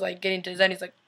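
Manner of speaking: usual speaking voice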